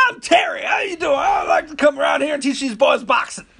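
very high, loud falsetto greeting; high squeaky Terry voice. Retold or imitated. Boxer imitating a strange trainer greeting him, shrill falsetto. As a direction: squeal it like an odd excited old trainer. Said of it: "He goes".

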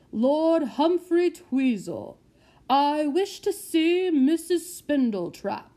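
loud, slow, lofty gentleman's voice; haughty lord. Acted. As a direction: announce yourself like a pompous lord.

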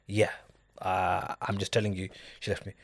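quiet, choked-up retold words; tearful retelling. Friend in tears telling Noor his wife left, softly choked. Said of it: "he busted in tears and he goes".

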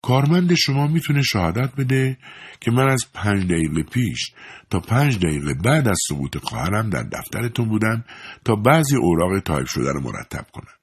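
a hint of icy calm; eerily calm. A narrator's reading. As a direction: state the alibi with chilling composure. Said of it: "با آرامشی دلهره آور گفت".

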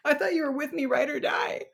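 very high, laughing, fake-wounded wail; laughing mock betrayal. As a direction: wail at followers through laughter. Mock betrayal to lost followers.

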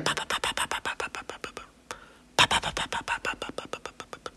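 pasitos susurrados, pa pa pa sin voz; pasos imitados. Retold or imitated. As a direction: imita bajito los pasos de una niña corriendo. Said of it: "Y seguía la niña". (susurra pa pa pa como pasitos lejanos)

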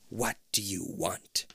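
slow, soft creature demand; menacing monster demand. Acted. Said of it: "the Queen demands".

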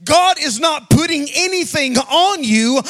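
loud, slow, sung-out preaching cadence; full-force preaching. Acted. Pastor preaching to the congregation, loud sung-out cadence. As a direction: preach it out over the music.

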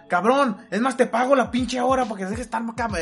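mock yell. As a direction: yell it like the fight in the taxi. Friends yelling in the taxi.

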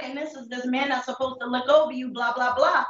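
usual speaking voice

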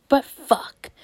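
ordinary delivery

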